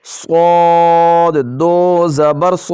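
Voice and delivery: صاد said heavy, full and slow; heavy sad. Shown as a demonstration. Teacher showing the heavy reading of صاد. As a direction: give صاد a thick, heavy sound. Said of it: "صاد کو ہم نے موٹا پڑھنا ہے".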